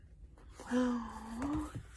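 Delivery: long, high breathy gasp; drawn-out amazed intake; astonished gasp. Real, not acted. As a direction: gasp at a revelation. Chapter-ending revelation.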